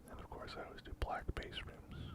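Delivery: ordinary talk, nothing marked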